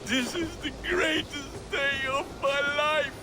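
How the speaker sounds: joyful shout. Acted. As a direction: shout it high with joy.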